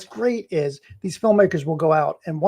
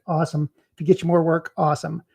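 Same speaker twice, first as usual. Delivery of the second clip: ordinary talk, nothing marked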